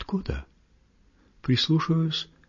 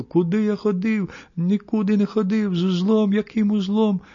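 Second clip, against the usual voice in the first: put-on high-pitched whining protest of a blind boy; acted tearful wailing; 11 semitones higher than usual. A narrator's reading. Blind boy protesting to the woman pulling his ear, tearful wail. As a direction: wail pleadingly, voice pitched right up. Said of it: "заплакал, закричал, заохал".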